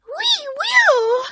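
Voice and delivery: very high, loud mouse squeal; shrill it out like a crowd of eager mice; shrill mice chorus. A narrator's reading. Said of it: "cried all the mice in a shrill chorus".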